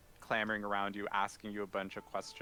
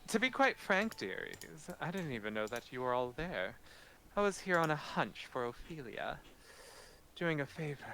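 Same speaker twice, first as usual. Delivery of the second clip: higher-pitched, frank archmage lady; airy voice with a high swoop at the start; breezy archmage; 6 semitones higher than usual. Acted. Archmage addressing the party she rescued, breezy and higher-pitched.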